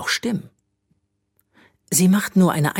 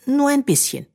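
put-on much higher, begging child; pleading; 14 semitones higher, 3 dB louder than usual. A narrator's reading. Bö begging Magnesia for a chance, high pleading. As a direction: plead for just a little. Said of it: "flehte Bö".

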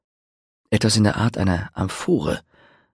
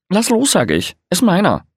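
much higher, louder shrill grab; the bard fights the witcher for the jug, shouting; angry shout; 10 semitones higher, 5 dB louder than usual. A narrator's reading. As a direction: yell it while yanking the jug away. Tussle over the jug.

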